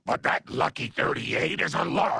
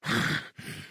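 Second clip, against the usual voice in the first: spent sigh after an outburst. Acted. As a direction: let out a breath as control returns. Recovering after losing control.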